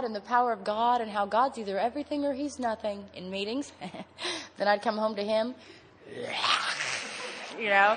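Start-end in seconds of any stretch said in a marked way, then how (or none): 6.1-7.5 harsh unpitched snarl; mock monster roar. Woman acting out coming home to her husband, mock roar. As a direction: let out a monstrous roar in mockery.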